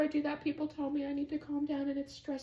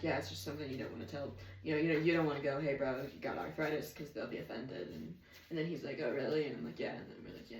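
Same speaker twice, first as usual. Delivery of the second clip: low, hushed retelling of a chat; reenacted quiet exchange; 11 semitones lower, 8 dB quieter than usual. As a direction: replay the conversation softly and low. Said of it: "I've stopped the, the reenacting".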